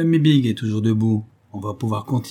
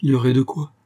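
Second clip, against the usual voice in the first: ordinary delivery, nothing marked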